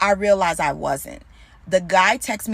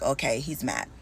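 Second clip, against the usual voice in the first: muttered thought; 8 semitones lower, 7 dB quieter than usual. Retold or imitated. Thinking to herself about boyfriend.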